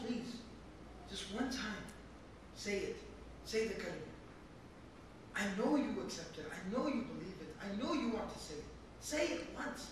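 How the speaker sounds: soft, low, loving plea; gentle pleading. Acted. Nephew at his dying uncle's bedside, pleading gently. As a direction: beg tenderly and quietly, leaving pauses. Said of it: "gentle, loving tone".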